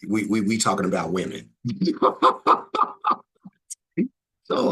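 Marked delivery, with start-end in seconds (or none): 1.6-3.1 loud, high rattling laughter; hearty laugh. Real, not acted. Viewer who knew that was coming, hearty laugh. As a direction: crack up in a quick staccato burst. Laughing at joke about women.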